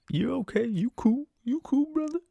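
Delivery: high-pitched mock reassurance; male passenger. Guy brushing off a request to change song, high mock voice. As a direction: imitate the guy, high and insistent. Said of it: "the guy was just like".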